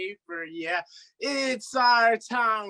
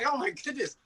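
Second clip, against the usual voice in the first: ordinary talk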